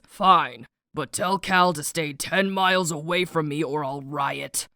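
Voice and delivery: low, grudging dad voice; grumpy dad. Acted. Nix reluctantly agreeing to join Mare, grumpy low voice. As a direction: give in grudgingly, pitch dropped. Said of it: "fully on his grumpy red dad energy".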